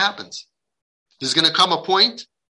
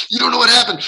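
higher, louder acted outburst; angry retort; 8 semitones higher, 5 dB louder, much faster than usual. Kid answering the adult who asked what happened, mock angry retort. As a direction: imitate a worked-up kid snapping back.

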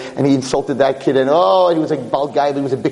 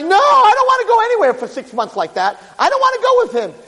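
put-on frightened boy. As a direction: refuse in fear. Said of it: "And he's like".

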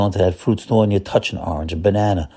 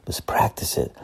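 whispered to yourself; 7 dB quieter than usual. Shown as a demonstration. Rabbi showing how to say it privately, whispered. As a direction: say it under your breath, just for you. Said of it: "Just say it to yourself".

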